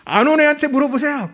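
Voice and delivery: high-pitched, lightly acted student backtalk; mock annoyed retort. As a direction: imitate a pupil snapping back at the teacher. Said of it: "화를 내더래". (살짝 흉내 낸 학생의 짜증 섞인 말대꾸)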